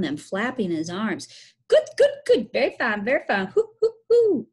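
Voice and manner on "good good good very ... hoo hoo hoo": high, loud birdlike hooting cries; bird-like crying. Acted. Billy flapping round the barn acting crazy, birdlike screeching. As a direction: squawk it out in high, punchy bursts. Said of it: "screeched Billy".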